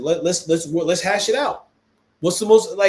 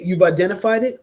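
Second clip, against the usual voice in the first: ordinary talk, nothing marked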